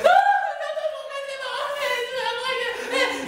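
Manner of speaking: very high squealing voice, laughing; high panicked Julie. Retold or imitated. Woken girl squealing in panic at Néron. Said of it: "elle fait".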